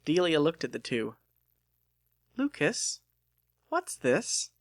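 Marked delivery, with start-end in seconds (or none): 2.4-4.5 put-on curious girl. Delia curious about the gift.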